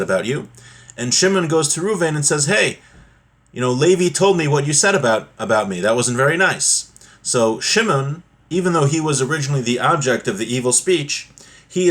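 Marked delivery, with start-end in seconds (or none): none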